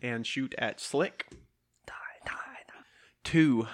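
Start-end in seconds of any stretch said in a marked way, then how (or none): 1.9-2.7 whispering. Real, not acted. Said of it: "rolling dice, muttered plea".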